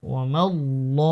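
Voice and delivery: ওয়া মাল ল with a thick, full-mouthed l; heavy lam. Shown as a demonstration. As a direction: make the l of ল heavy, with a rounded vowel. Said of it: "মোটা পড়তে হবে".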